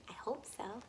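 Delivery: whispered hope. Real, not acted. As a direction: breathe it in a whisper. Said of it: "unvoiced whisper".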